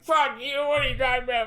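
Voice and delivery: high mock protest; drunk retort. Telling mom's boyfriend off, high and drunken.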